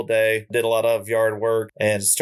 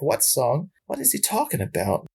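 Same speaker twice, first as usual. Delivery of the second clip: confused; 4 semitones higher, 3 dB quieter than usual. Retold or imitated. Confused at three a.m.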